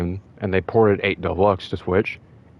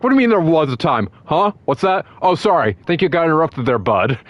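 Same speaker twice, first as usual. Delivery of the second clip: higher, louder mock-sarcastic comeback; smug taunt; 8 semitones higher, 4 dB louder than usual. Sonic account roasting a YouTuber, smug and mocking. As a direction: tease him like a cocky rival.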